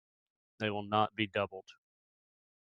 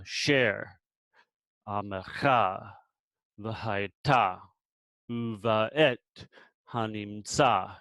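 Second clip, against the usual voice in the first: "amecha", "v'hayta", "hanimtsa" with a long, stressed last syllable; final-syllable stress; much slower than usual. Shown as a demonstration. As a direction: land hard on the end of "share, amecha, v'hayta, uvaet, hanimtsa". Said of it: "this is where you accent this word".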